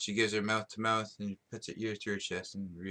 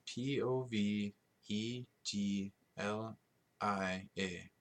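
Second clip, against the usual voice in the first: spelling it out; much slower than usual. Shown as a demonstration. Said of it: "say it more with an Italian accent".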